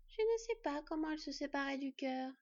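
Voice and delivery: put-on icy detached fox girl; cold and distant. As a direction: speak as if nothing touches you. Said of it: "La voix de la renarde était toujours aussi froide et distante".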